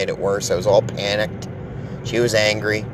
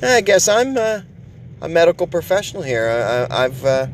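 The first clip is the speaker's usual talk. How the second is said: put-on reading his smug text to the girlfriend; 4 semitones higher than usual. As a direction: gloat like an expert. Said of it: "is what I told her".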